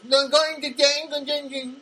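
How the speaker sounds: put-on high, goofy Spanish-accented gibberish; slow, squawky mock Spanish accent; Spanish Jerry Lewis. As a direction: babble nonsense in a cartoonish Spanish accent. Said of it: "like a Spanish Jerry Lewis".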